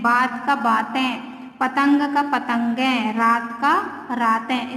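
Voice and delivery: slow, clear word pairs for students; slow clear drill. Shown as a demonstration. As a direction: read each pair slowly, like a drill. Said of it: "गेंद का गेंदें". (धीमे, साफ़ उच्चारण से शब्द जोड़े)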